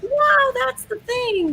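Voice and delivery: high, drawn-out pretend wonder; mock amazement. Host pretending to be wowed by the bet, mock amazement. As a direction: act amazed at a predicted line. Acts out the group's future reaction.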